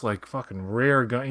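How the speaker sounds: ordinary delivery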